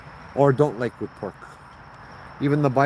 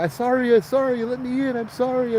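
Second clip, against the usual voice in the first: high panicked sorry; locked-out boyfriend begging to get in, high and panicky; retold panicky apology; 13 semitones higher than usual. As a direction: plead sorry in a high strained voice. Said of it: "you hear the guy".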